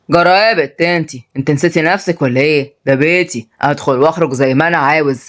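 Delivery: low-voiced overbearing uncle; domineering uncle, scolding. Uncle tells niece off over the house, scolding. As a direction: put her in her place, low.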